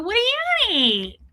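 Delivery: put-on high childlike voice; childish innocent question; five-year-old kid. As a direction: ask like a clueless little kid. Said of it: "like a five-year-old kid".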